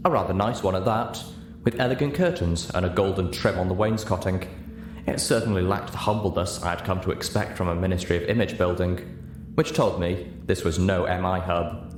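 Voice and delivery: ordinary talk, nothing marked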